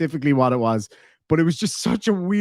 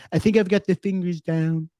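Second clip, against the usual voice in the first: usual speaking voice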